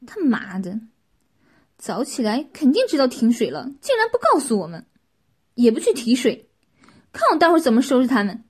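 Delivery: loud, indignant rant to oneself; angry grumbling aloud. A narrator's reading. Qin alone, cursing neighbours who hid the water cut, loud and angry. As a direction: curse them loudly, building to a threat. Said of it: "秦自言自语道".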